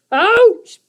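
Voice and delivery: high, loud yelp of pain; a goblin hit by the basket; creature yelp. A narrator's reading. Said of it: "burbled Guff".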